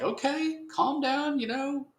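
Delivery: high mock voice talking someone down; calm him down in a high put-on voice; placating buddies done in a high silly voice; soothing street buddies. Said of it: "and they're like".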